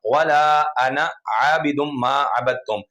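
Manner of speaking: measured recitation with held long vowels; Quran recitation. Shown as a demonstration. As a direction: draw out the long vowels as in tilawat. Recites a Quran verse as example.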